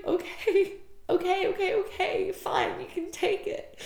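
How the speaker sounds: high-pitched, tearful giving in; tearful surrender. Retold or imitated. Her finally letting the guide take it, tearful surrender. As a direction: give in reluctantly through tears. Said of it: "I'm just crying and releasing so deeply".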